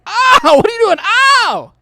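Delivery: high, very loud cry of pain; hammed-up agonized shout; pained yelling. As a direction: yelp in protest at being hurt. Acts out patient being yanked.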